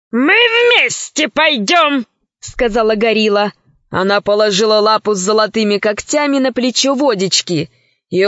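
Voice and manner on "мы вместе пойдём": very slow heavy gorilla voice. Acted. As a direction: drag it out like a beast. Gorilla insisting.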